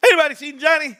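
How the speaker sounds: teasing a viewer who vanished; mock search call. Looking for a missing viewer.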